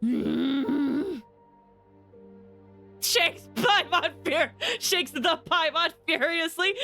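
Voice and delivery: ordinary talk, nothing marked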